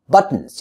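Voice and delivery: buttons as but-nz. Shown as a demonstration. Teacher modelling natural speech, buttons with bare N ending. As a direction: drop the second vowel in buttons, T straight into N. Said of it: "I say buttons".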